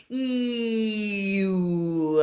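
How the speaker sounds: you'll gliding from ee to oo; ee-oo glide. Shown as a demonstration. Coach shows the glide in you'll. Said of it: "So there's that glide".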